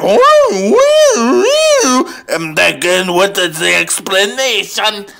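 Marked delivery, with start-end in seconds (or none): 0.0-2.1 high imitated siren wail; police siren. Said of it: "the police will show up like".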